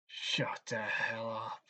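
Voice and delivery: slow hissed whisper; menacing whisper to the brat; whispered threat. Acted. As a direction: hiss it low and menacing, slowly.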